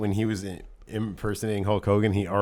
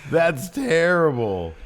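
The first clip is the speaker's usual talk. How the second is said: ordinary talk, nothing marked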